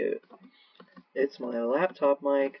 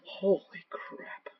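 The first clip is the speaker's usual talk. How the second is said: ordinary talk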